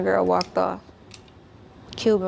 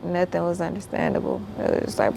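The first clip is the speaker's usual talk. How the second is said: normal talking voice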